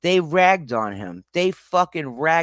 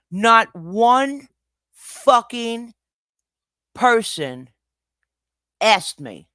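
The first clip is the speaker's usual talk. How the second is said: loud, higher, furious outburst; angry rant; 8 semitones higher, 4 dB louder than usual. Real, not acted. Streamer yelling at the chat over rumors, furious and loud. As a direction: rip into the chat for spreading rumors. Streamer angrily rebuts the clip.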